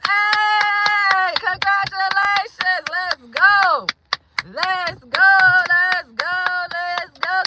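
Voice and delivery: high, drawn-out celebratory chant; real excited congratulations; cheering. Real, not acted. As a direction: hype the viewer up. Cheering viewer's subscriber count.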